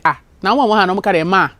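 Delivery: usual speaking voice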